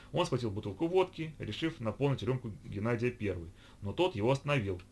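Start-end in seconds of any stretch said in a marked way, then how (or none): none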